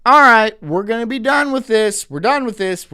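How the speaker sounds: a loud mock crowd declaring it is done; fed-up shout. Voicing fed-up people, shouting that they are done. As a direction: shout it like people who have had enough. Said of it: "people are gonna start being like".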